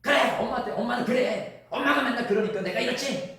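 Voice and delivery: high, loud, angry retort with a laugh in it; hurt daughter lashing back, pitched high and loud; daughter's angry reply. Retold or imitated. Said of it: "오히려 화를 내고 돌아왔더라는".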